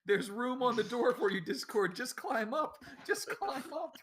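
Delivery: high, hammy tearful plea; pleading at a sinking friend; mock Titanic plea. Titanic parody to Discord.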